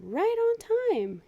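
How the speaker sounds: high, slow, sing-song reply; proud Amos. Acted. Amos answering the bus driver, high and slow. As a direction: stretch it out up high, pleased.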